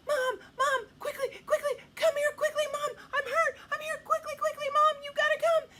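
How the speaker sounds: put-on high, loud child crying for mom; fake panicked calling. Boy in his room faking hurt to summon mom, shouting. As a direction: call out urgently, hammed-up and high.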